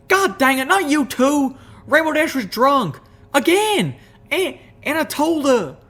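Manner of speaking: high, loud flustered drawl; flustered country mare. Acted. Applejack defending herself to Rarity, high loud protest.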